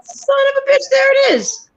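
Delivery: high astonished exclamation; surprised. Retold or imitated. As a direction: blurt it out in shock. Retold surprise at video.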